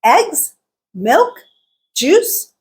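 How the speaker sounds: EGGS, MILK, JUICE each swept up, with pauses; unfinished list, every item going up; rising list items. Shown as a demonstration. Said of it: "all three went up".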